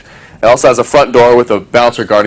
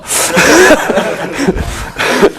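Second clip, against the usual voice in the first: laughing; 9 semitones higher than usual. Real, not acted. Laughing at the movie scene.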